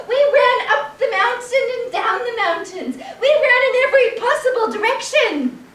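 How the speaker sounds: high, loud, indignant rant; furious mob. Acted. Angry mob ranting at the chicken, furious and loud. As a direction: rail at the chicken. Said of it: "Then they became furious".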